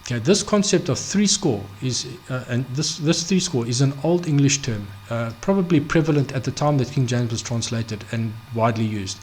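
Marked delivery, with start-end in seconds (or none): none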